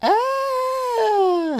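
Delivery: long high falsetto scream. Acted. Girl screaming in the fanfic, high falsetto. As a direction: hold a high shrill cry. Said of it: "She screamed".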